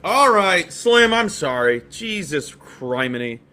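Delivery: loud, drawn-out exasperation; exasperated apology. Real, not acted. Host apologising to a complaining viewer, exasperated. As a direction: start loud and fed up, slow down into a grumble.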